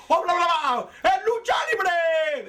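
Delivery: high, drawn-out mock announcer scream; screaming commentator. Imitating a commentator he couldn't understand, excited screaming. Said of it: "the guy's screaming".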